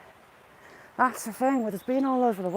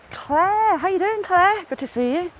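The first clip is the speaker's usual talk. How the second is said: sing-song greeting; 9 semitones higher than usual. Real, not acted. Greeting Claire in the live chat, high and sing-song.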